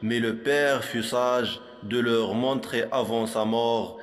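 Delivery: normal talking voice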